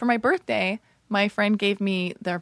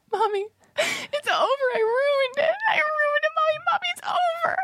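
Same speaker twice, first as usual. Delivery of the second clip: high, hammed-up crying to mommy, much higher; mock sobbing wail; slower than usual. Calling her mom the next day, hammed-up sobbing wail. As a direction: wail it like a melodramatic child, half-shouting.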